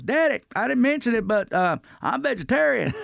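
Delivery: high-pitched imitation of a friend confessing; Ralphie May. Comic imitates friend turning down the steakhouse, high-pitched confession. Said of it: "And he goes".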